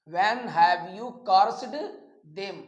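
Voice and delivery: very slow, each word separate; slow model reading. Shown as a demonstration. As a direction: read it out slowly for students to copy. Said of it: "model sentence read slowly". (धीरे-धीरे पढ़ा गया वाक्य)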